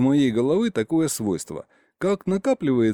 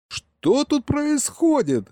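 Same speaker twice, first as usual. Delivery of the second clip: very high, drawn-out bewildered yell; 14 semitones higher, slower than usual. A narrator's reading. Boy demanding to know what's happening, yelling. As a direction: cry out the question, pitch soaring. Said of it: "снова заорал я".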